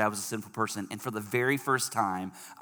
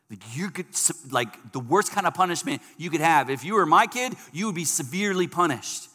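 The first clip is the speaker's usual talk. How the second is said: raised, driving sermon voice; preaching; 5 semitones higher, 7 dB louder than usual. Acted. Pastor warning the kids about punishment, preaching hard. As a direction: press the point home to the kids like a preacher.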